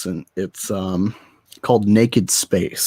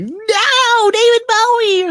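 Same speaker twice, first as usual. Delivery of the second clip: very high, loud wail; mock fan outcry; 7 dB louder, much slower than usual. Mocking everyone defending the movie, high loud wail. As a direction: shriek it like an outraged fan. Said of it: "everyone's gonna be like".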